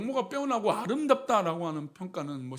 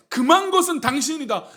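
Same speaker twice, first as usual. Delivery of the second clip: loud, raised-pitch pronouncement; clear, emphatic declaration; 6 semitones higher, 8 dB louder than usual. Acted. Joseph telling his master's wife she is forbidden, emphatic declaration. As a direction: state it forcefully and unmistakably. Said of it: "지금 요셉은 분명하게 말합니다".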